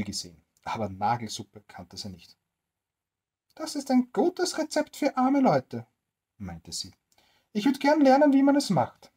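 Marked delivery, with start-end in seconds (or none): 3.5-9.1 put-on high, keen old woman voice; eager old woman. Old woman wanting to learn nail soup, high and eager. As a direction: lean in as a greedy old woman, pitched high.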